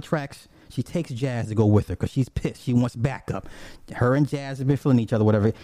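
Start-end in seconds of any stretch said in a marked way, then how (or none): none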